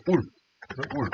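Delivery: "울" said alone as the first syllable of ultrasound; pronunciation demo. Shown as a demonstration. Teacher showing how ultrasound begins, "울" sounded out alone. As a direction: isolate "울", the vowel-first opening of ultrasound. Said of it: "어떻게 발음하죠".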